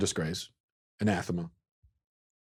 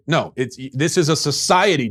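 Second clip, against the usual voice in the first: usual speaking voice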